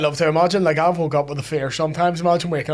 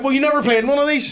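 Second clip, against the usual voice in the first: higher, pointed jab at the pundit; mock-scoff at Shearer; higher sneering viewer retort; 9 semitones higher than usual.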